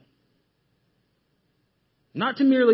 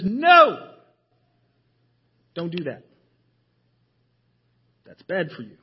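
God's warning voice; 2 semitones lower than usual. Acted. God's voice warning through scripture.

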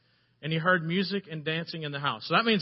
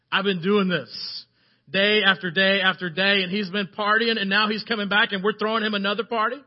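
loud, raised-pitch indignant protest; angry older brother; 4 semitones higher, 7 dB louder than usual. Acted. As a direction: build to an outraged question. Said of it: "He's angry".

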